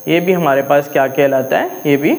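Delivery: normal talking voice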